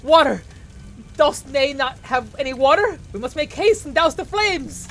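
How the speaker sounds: high, loud theatrical alarm; hammed-up knight shouting for help; panicked archaic cry. Archaic character panics over fire.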